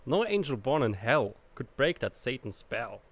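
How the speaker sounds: ordinary delivery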